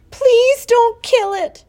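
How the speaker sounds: high, loud, drawn-out wailing plea; sobbed plea. A narrator's reading. Daughter begs father to spare the pig, sobbing. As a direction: beg desperately, very high and slow. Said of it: "she sobbed".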